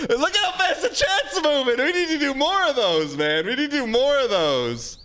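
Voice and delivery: half laughing with delight at the fast chat; excited. Real, not acted. Chat moving fast, streamer thrilled.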